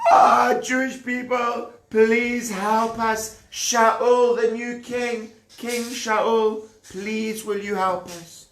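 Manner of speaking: high, wailing call for help, hammed-up; mock crying plea. Besieged townspeople begging King Shaul for help, mock tearful. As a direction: plead with a pretend sob in the voice.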